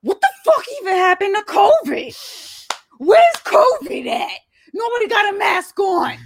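squealing panicked shout; very high yell. Retold or imitated. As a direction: shout it way up, baffled. Quoting friend about covid.

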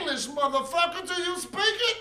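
high-pitched mock interrogation; movie-quote impression. Taking a turn at the film line, put-on.

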